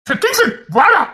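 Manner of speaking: high call across the room. Acted. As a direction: shout for him to come. Calling out to Kingsley.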